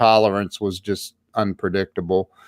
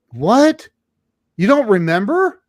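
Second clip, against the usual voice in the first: high, louder disbelieving questions; incredulous; 11 semitones higher, 4 dB louder than usual. Retold or imitated. Job seeker to forgetful drinker, incredulous. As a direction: imitate someone stunned at being forgotten.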